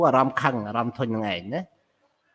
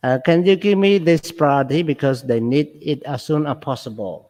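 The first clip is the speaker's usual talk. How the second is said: fast reading; much faster than usual. Shown as a demonstration. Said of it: "reading workplace sentences aloud".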